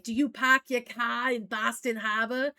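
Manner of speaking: lightly imitated Boston accent, dropped r's; a tourist's mocking Boston accent, retold; imitating a Boston accent. Tourist teasing the teen dishwasher about Mainers, imitated Boston accent. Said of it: "totally making fun of Mainers".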